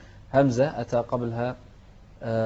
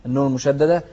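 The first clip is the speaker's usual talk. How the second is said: ordinary talk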